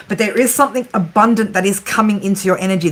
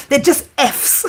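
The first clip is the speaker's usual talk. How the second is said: laughing while quoting son; 5 semitones higher, slower than usual. Retold or imitated. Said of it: "He's like".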